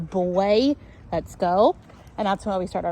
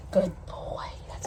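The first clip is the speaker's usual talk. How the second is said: whispered praise; 7 dB quieter than usual. Real, not acted. Quiet praise to the dog.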